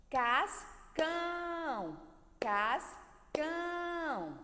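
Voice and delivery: "Cascão" split, CAS short, then CÃO high and held long; stress on CÃO. Shown as a demonstration. Teacher showing the sílaba mais forte, last syllable held long. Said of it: "observe qual é a sílaba mais forte".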